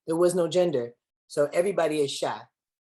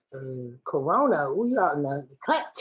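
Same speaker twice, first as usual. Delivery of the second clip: normal talking voice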